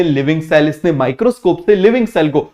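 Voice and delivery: ordinary talk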